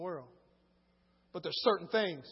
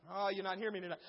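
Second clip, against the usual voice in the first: ordinary talk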